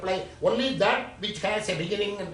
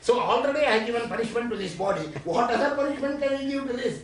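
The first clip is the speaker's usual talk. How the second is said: higher, louder, rapid-fire challenge; defiant retort; 6 semitones higher, 4 dB louder, much faster than usual. Acted. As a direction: throw the punishment back at them, fast and high. Acting out the defiant reply to punishment.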